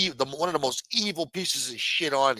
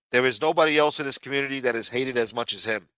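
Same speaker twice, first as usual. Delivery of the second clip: ordinary delivery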